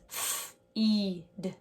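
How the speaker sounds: f held alone, then a long "e-d"; sounded out. Shown as a demonstration. Teacher sounding out a word for pupils, sound by sound. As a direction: stretch "f-, e-d" into separate sounds. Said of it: "word that has the E sound".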